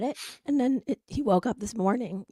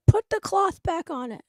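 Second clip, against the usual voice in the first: put-on bossy crying kid; 7 semitones higher, 6 dB louder than usual. Crying son demands.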